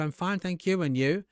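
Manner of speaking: robotic reply. Shown as a demonstration. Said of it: "You're acting like a robot".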